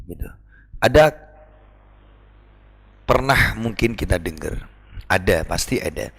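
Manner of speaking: ordinary delivery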